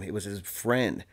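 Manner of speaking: normal talking voice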